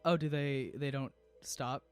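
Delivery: normal talking voice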